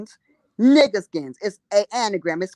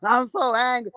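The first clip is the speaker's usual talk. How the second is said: mock anger. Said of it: "he got so".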